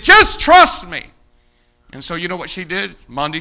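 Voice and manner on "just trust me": loud, higher, slow "Just trust me"; emphatic plea. Retold or imitated. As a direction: plead it out with force. Pastor's reassurance in story.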